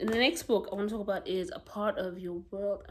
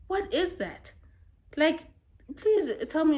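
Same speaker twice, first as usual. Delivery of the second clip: exasperated disbelief; 7 semitones higher, 5 dB louder than usual. Real, not acted. Baffled by uncaring people.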